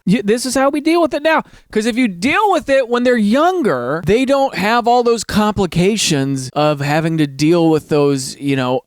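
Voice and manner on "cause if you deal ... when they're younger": ordinary talk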